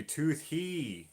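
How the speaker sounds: "tooth he" as two separate words; sounding out a name. Shown as a demonstration. Streamer working out a viewer's name, sounding out "tooth he". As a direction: stretch "tooth he" with a rise and fall on "he". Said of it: "Toohey rather than toothy".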